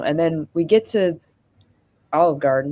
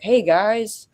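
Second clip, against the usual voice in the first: lightly put-on cheery streamer voice; a hint of a chirpy livestream host, mocking; mock streamer intro; 3 semitones higher than usual. Sarcastically pretending to start a stream at dinner, a lightly put-on streamer voice. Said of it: "I'll be like".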